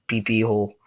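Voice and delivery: ordinary talk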